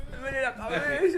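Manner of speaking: high-pitched mock whine; hungover complainer. Host mimics himself with a hangover, high mock complaining. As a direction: whine like a helpless hungover patient. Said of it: "Y de resaca también".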